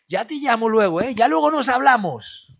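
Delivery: high, loud breezy brush-off; mock brush-off. Preacher imitating a friend who never calls back, mocking. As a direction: fob them off cheerily. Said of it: "ese que te dice "ya luego nos hablamos".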